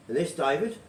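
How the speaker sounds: ordinary talk, nothing marked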